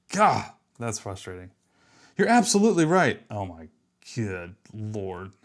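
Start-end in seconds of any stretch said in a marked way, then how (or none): 2.2-3.2 high, loud sing-song parody of the chatbot; lightly mock the assistant's eager agreement. Coder parodies the AI's stock reply, mocking and high.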